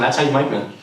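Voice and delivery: usual speaking voice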